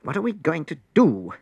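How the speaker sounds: high, anxious whine; whining. A narrator's reading. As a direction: whine in panic. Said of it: "they whined".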